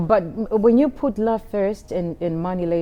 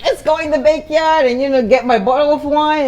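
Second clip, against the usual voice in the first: playful invitation; 8 semitones higher, 8 dB louder than usual. Retold or imitated. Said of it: "I said".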